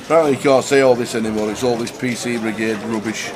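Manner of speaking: ordinary talk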